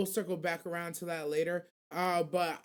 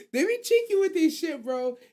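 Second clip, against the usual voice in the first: high, loud burst of delight; excited exclaim; 7 semitones higher, 6 dB louder than usual. Real, not acted. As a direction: yelp it out with glee. Gleeful exclaim about the cheeky scene.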